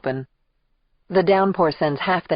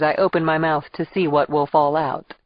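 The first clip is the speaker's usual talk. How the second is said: ordinary delivery, nothing marked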